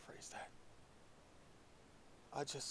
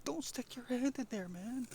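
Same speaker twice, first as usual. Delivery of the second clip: high-pitched, louder imitation of a warner; mock warning; 11 semitones higher, 5 dB louder, slower than usual. Imagined onlooker warning him.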